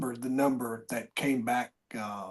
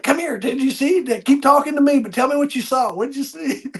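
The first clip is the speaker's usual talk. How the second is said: eager urging; 9 semitones higher, 4 dB louder than usual. Retold or imitated. Eagerly pressing the patient.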